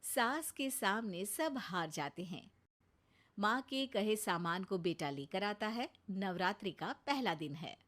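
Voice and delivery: even storyteller voice introducing the scene; sketch narration. A narrator's reading. Narrator opening the family comedy sketch, storytelling. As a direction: set up the comic story plainly.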